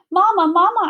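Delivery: loud, high call across the street. A narrator's reading. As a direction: call out to get Mama's attention. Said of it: "I call".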